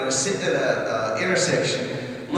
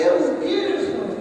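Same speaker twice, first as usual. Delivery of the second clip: lightly voiced wife, much higher and gushing; imagined wife gushing. Husband imagines his wife's gushing reply, high mock voice. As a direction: play the dreamy wife, pitched way up. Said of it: "and say".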